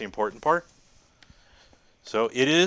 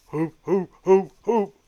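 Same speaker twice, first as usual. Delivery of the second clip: playful hooting; 6 semitones higher, 6 dB louder, slower than usual. Pretend. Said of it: "it does sound kinky".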